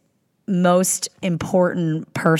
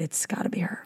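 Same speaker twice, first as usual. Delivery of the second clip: whispering; 12 dB quieter than usual. Real, not acted. Secretive reveal of a celebrity.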